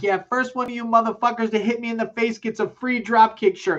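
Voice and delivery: high mock rocker voice taunting the crowd; imitating Kenny. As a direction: play the frontman goading fans, pitched high. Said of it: "He's like".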